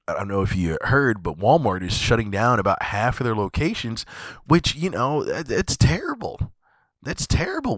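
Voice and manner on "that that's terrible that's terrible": high, sarcastic dismay on "terrible"; sarcastic high-pitched "terrible"; mock outrage. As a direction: fake shock, pitched up.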